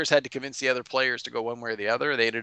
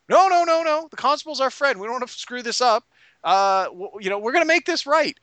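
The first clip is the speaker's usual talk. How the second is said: high, urgent mock pleading; panicked pleading; 10 semitones higher, 4 dB louder than usual. Players placating the bar owner, panicked. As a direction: plead hastily, starting loud and high. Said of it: "And they're like".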